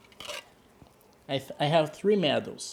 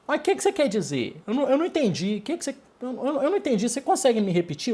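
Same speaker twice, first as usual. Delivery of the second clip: higher, fast puzzled asking in Portuguese; a lost student asking to repeat, a touch higher; confused student asking; 6 semitones higher, much faster than usual. Shown as a demonstration. Student who didn't understand asks teacher to repeat, confused. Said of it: "Depending on the intonation, depending on the pitch of your voice".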